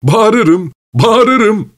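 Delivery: very high-pitched mock cry; joking threat. A narrator's reading. Pavel playfully warns Vilchanov he will yell, mock-alarmed. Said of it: "dedi Pavel Pavlovich yine şaka yollu gülerek".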